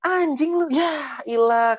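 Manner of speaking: high drawn-out mock cursing; exaggerated high-pitched swearing; crude, pushy outburst. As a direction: imitate a coarse woman snapping. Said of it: "nggak suka perempuan yang".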